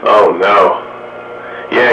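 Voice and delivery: usual speaking voice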